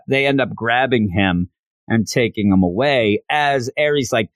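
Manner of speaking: ordinary delivery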